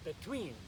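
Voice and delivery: "Between" stressed on its second syllable, be-TWEEN. Shown as a demonstration. Teacher models for learners, "Between" stressed on "tween". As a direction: lift the "tween" of "Between", then fall. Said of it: "accent is on the second syllable".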